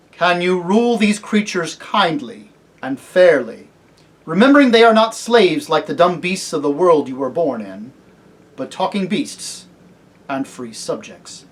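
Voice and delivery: loud, deliberate questioning; solemn kingly lion. Acted. Aslan asking if he can rule fairly, solemn. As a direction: put it as a grave royal charge.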